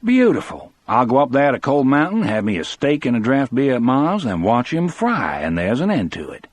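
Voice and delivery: lightly mock a smug sheriff settling the matter; a hint of a complacent sheriff; sheriff's imagined thoughts. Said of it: "thinks he".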